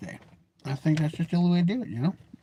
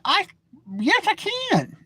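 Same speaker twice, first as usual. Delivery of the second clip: high, defiant comeback; defiant retort; 13 semitones higher, slower than usual. Retold or imitated. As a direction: snap back high and stubborn. Retorting to daughter.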